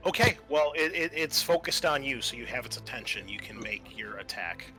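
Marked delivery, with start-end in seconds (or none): none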